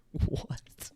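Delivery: whispered, drawn-out "what"; stunned whisper. Retold or imitated. Imitating Andrew stunned again, whispered disbelief. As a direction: breathe it out in disbelief.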